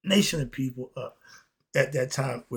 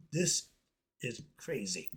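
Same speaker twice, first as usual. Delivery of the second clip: ordinary talk, nothing marked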